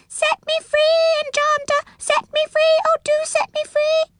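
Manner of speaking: tiny, very high squeaky plea; small squealing voice. A narrator's reading. Prisoner in the kettle begs the enchanter, squealing. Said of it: "came a small squealing voice".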